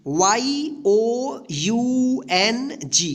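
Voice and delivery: letters Y O U N G spelled very slowly; slow spelling. Shown as a demonstration. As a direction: spell out each letter for students. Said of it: "क्या होगा?". (धीरे-धीरे spelling)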